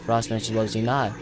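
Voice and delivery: ordinary talk, nothing marked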